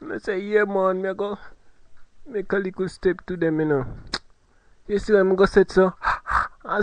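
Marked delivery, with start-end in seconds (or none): none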